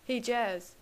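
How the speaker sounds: hammed-up call to a friend; dramatic call out. A narrator's reading. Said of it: "he called out dramatically".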